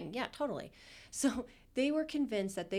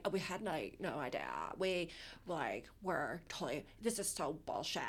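put-on Valley Girl accent; 4 dB quieter than usual. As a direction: do a bad Australian-tinged Valley Girl. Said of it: "my best way of doing the Valley Girl".

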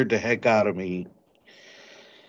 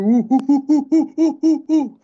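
repeated high whoops, much higher and louder; imitated simian whoops; 5 dB louder than usual. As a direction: whoop like an ape, sharp and rhythmic. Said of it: "a simian type of scream".

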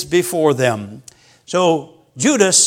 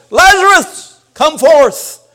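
ringing call, much higher and louder; loud cry; 9 semitones higher, 7 dB louder, slower than usual. Acted. Jesus calling Lazarus from the tomb with a loud cry. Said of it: "he cried with a loud voice".

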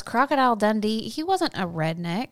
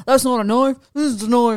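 louder mock Aussie movie line; Crocodile Dundee quote; 5 semitones higher, 9 dB louder than usual. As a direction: ham up the famous knife line in an Aussie voice.

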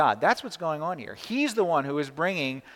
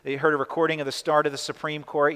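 ordinary talk

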